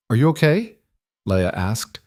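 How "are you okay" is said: higher, tentative question; uncertain. A narrator's reading. Wife checks on husband after pregnancy news, uncertain. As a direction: ask with sudden mild unease. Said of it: "suddenly uncertain".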